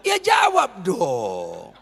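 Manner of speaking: slow, drawn-out shout at the crowd; urging shout. Real, not acted. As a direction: demand an answer from them. Urging congregation to answer.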